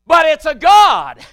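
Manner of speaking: loud, high, slow holler; preacher's shout. Acted. Preacher crying out to the church about idols, shouting. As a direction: hit the roof with it.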